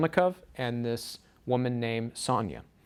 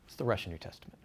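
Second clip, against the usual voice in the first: ordinary talk, nothing marked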